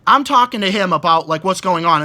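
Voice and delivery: normal talking voice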